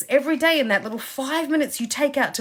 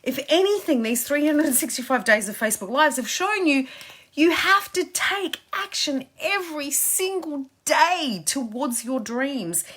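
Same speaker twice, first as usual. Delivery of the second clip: usual speaking voice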